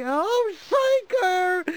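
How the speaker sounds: hammed-up blissful sigh; dreamy ad delight. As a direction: melt over the pancake like a commercial. Savoring mom's pancake, ad-like.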